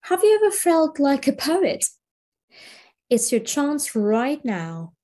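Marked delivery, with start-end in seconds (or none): 0.0-1.8 higher, eager question; lively invitation. Shown as a demonstration. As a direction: draw students in with excitement. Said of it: "teacher invites the class".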